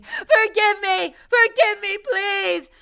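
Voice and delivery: pleading shout, dragged out slowly; hammed-up desperate plea; retold scream. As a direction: beg for forgiveness at the top of the voice. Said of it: "shook her by the shoulders and screamed".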